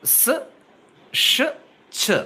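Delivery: స్, ష్, చ each alone, voiceless, with pauses; isolated consonant sounds. Shown as a demonstration. Teacher demonstrating the three voiceless sibilants, isolated sounds. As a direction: sound out స్, ష్, చ one by one. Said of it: "ష sound and చ sound".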